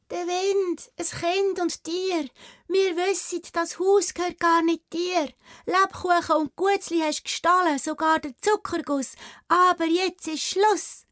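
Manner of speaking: high, bold, clear proclaiming; loud and clear. Acted. Anna boldly answering the witch from outside the house. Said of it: "laut und deutlich zur Antwort".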